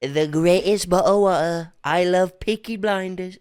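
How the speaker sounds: hammed-up Cockney voice, "Bottle of water" with no Ts; mock British accent. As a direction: overdo Trump faking a British accent. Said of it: "The P syllable fucked me".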